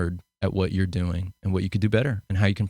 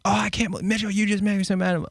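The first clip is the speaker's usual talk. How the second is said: high mock-upset mom voice, higher pitch; hammed-up fretting mother, pitched up; imitating angry mom; 11 semitones higher than usual. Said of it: "it went from her being like".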